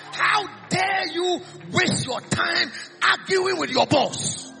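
usual speaking voice

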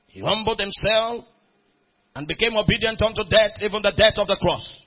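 reads the verses with rising force; scripture reading building. Acted. Preacher to congregation, reading Philippians with growing force. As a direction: build the scripture toward a cry.

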